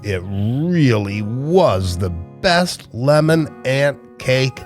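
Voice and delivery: ordinary delivery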